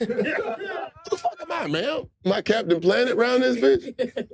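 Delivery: high, laughing disbelief; incredulous laugh. Real, not acted. Incredulous at climate question.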